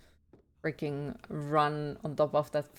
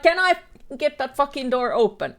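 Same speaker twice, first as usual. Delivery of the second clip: high, loud impatient outburst; exasperated demand; 7 semitones higher, 6 dB louder than usual. Acted. Streamer fighting a door as the monster comes, exasperated. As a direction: demand it loudly, fed up rather than afraid. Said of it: "instead of being afraid of the monster".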